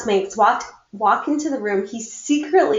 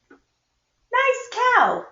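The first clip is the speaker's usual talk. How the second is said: teasing classmate; 12 semitones higher than usual. Acted. Classmate teases his drawing.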